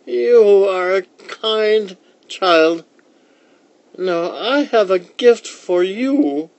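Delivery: put-on high, loud, slow old lady voice; slow high-pitched old-woman character voice. A narrator's reading. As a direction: play the old woman, stretching words out high.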